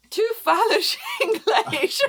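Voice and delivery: giggling mock fail-safe "Tu falas English?"; laughing joke line. Shown as a demonstration. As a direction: crack yourself up on the word "English".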